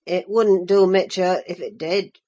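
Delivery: low, rough Yorkshire farmhand; vulgar Yorkshire accent. Acted. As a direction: drop into a coarse Yorkshire lad. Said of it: "He answered in his vulgar accent".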